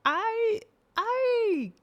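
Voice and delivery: high, loud, stretched cries; shrieked false start, overcome; exasperated outburst. Real, not acted. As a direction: wail it out in worked-up frustration. Mock rage after Hulk remark.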